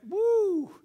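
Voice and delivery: high, loud whoop of awe; excited whoop. Real, not acted. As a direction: let out an amazed woo. Marvelling at the Garretts' praying.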